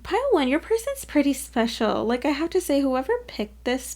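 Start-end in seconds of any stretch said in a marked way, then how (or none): none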